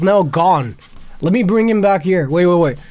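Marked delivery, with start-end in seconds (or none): none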